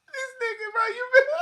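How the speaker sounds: high squeaky words through laughing; breathless high laughing squeal; helpless laughter. Real, not acted. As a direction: squeak it out while cracking up. Talking through a laughing fit.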